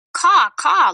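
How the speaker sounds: high, loud cawing raven. A narrator's reading. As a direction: caw like a raven. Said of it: "croaked Raven".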